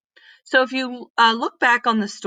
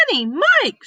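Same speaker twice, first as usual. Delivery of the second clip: higher, louder delighted greeting; cheerful Miss Reed; 10 semitones higher, 4 dB louder than usual. Acted. Kind neighbour greets the children at her door, higher and delighted. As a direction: greet the kids with bright surprise.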